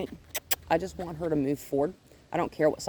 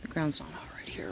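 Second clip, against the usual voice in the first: hushed, gentle praise to a nervous dog; calm her down quietly; soft soothing; 9 dB quieter than usual. Real, not acted. Trainer soothing a nervous dog, softly hushed.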